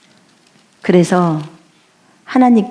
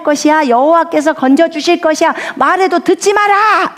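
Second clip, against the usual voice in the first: arrogant envoy; 8 semitones higher than usual. Acted. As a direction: sneer down at the besieged city. Envoy's taunting speech to Judah. (높은 목소리의 오만한 적장)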